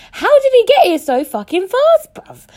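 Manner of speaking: very high, loud squeal of disbelief; incredulous outburst. Retold or imitated. Storyteller baffled how he got there so fast, high loud disbelief. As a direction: exclaim it in high-pitched amazement. Acts out her incredulous thought.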